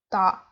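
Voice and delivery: 다 said alone, low and firm; stressed 다. Shown as a demonstration. Teacher isolating the last syllable, firm 다. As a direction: land hard on the final 다. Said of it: "You emphasize so and 다".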